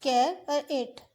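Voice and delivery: कैर and एट said as separate parts; split syllables. Shown as a demonstration. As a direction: break कैरेट into कैर and एट. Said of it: "इसके हम दो हिस्से करेंगे". (कैरेट को कैर और एट में तोड़कर बोलना)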